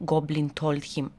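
ordinary delivery

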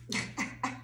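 a breathy little laugh under her breath; soft laugh. Real, not acted. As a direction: chuckle quietly to yourself. Tired, laughs at herself.

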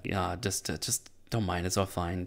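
hushed, offhand reassurance; imitating a wife soothing him under her breath; soft placating. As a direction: play it down very quietly. Voicing wife calming her husband.

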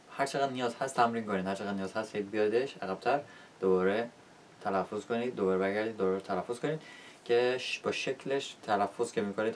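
ordinary talk

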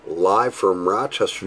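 normal talking voice

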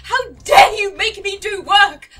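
loud, slow, outraged ranting; mock rant. Real, not acted. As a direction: tell off the traitor theatrically. Ranting at the game character.

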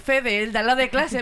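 ordinary talk

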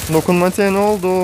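frantic, high and loud; alarmed. Real, not acted. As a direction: cry out at the broken controls. Touchscreen fails mid-fight.

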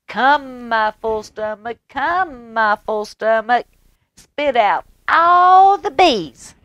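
high, loud little rooster chanting his spell; sing-song rooster commanding his stomach; rooster calling. Acted. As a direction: call it out like a proud little rooster. Rooster's magic call.

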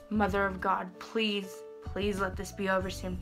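ordinary talk, nothing marked

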